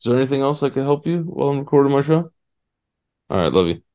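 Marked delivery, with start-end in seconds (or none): 0.0-2.3 fast, high, dismissive patter; mock douchey brush-off. Brushing off the girlfriend mid-show, smug and fast. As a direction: rush her off like a smug host. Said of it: "You sound like such a douchebag".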